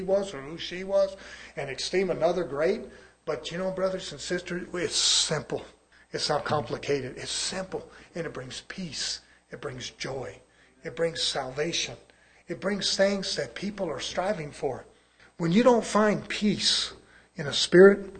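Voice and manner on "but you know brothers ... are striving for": soft, hushed preaching; quiet pulpit tenderness; gentle preaching. Real, not acted. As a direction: ease off and reassure the congregation softly. Preacher drops to gentle assurance.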